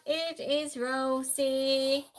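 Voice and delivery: slow, stretched answer announcement; drawn-out reveal. Real, not acted. Host revealing the robot maid's name, drawn out. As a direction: draw out every word of the reveal.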